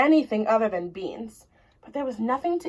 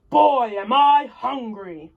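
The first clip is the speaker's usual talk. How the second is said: loud, slow giant's voice; booming giant; 6 dB louder, slower than usual. Acted. Mister Giant stomping toward the kitchen, slow booming call. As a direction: boom it out like a huge giant.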